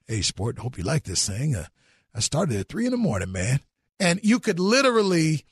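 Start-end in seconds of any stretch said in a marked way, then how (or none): 0.1-3.6 imitating dad. Dad voiced in a story.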